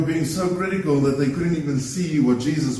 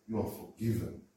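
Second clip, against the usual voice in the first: soft; 5 semitones lower, 12 dB quieter than usual. Retold or imitated. Contrast with healing quotes.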